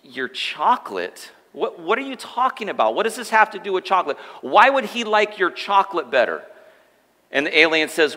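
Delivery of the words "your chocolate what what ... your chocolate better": incredulous leader. Retold or imitated. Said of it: "the religious leader is like".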